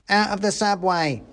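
high-voiced soldier chiming in; mouse soldier. A narrator's reading. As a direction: add it eagerly in a high mouse voice. Soldier mouse adds to slogan.

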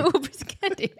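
high squeaky voice through laughter; laughing silly voice. Pretend. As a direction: giggle it out in a high coo.

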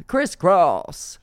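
high, loud exclamation; exclaimed. Pretend. Repeating it to co-host for comic effect, exclaimed high and loud.

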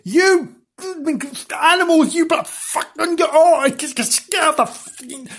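high, spluttering rage; hammed-up apoplectic rant; swearing gardener. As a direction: splutter half-bitten curses in mock fury. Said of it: "the gardener swore his head off".

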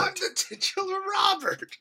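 very high, squeezed echo; hammed-up high-pitched protest; mock indignant star. Co-host echoing the affronted actor, in a high mock voice.

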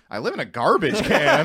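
mock bewilderment, lifting at the end; puzzled repeat. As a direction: repeat it as if baffled. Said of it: "after I hung up".

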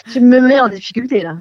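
parole entrecoupée de rires, plus fort; en riant. Real, not acted. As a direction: rire gêné en parlant. Rit, gênée par la question. (voix rieuse et gênée)

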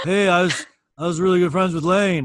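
hammed-up proud boast; mock rocker bragging loudly. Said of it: "He's like".

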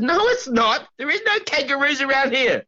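no kangaroos here, laughing and pitched high; laughing protest. Real, not acted. Insisting, laughing.

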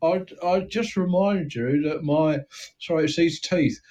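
a small-time crook boasting, thin Cockney voice; East End gangster. Acted. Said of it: "I'm searching for the right note for this voice".

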